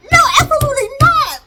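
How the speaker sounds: high, loud squeal of refusal; indignant refusal. Acted. Viewer objecting to husband walking off, indignant. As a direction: shut it down with loud mock outrage.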